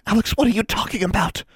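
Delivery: high, urgent hiss; tense, high-pitched challenge. A narrator's reading. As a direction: demand it sharply, alarmed. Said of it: "Anna hissed".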